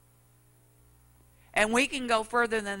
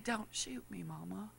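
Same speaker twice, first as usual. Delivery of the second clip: soft retelling; 16 dB quieter than usual. Retold or imitated. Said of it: "he said".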